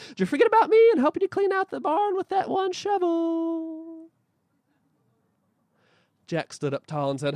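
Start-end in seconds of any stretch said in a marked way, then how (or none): 0.1-4.1 high hen voice; squawky hen speaking. Acted. Magic hen speaks to Jack.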